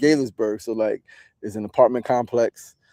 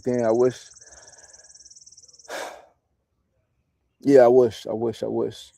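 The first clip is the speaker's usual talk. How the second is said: real emotion welling up. Real, not acted. Telling viewers about providing for his parents, choked up. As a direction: voice catching on the words, sighing through them. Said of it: "I just got mad emotional".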